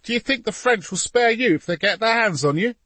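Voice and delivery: put-on urgent, high-pitched boy's shouting. A narrator's reading. Boy to papa about the French, high shouting. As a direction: shout a warning up, shrilly insistent.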